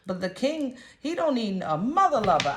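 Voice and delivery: ordinary delivery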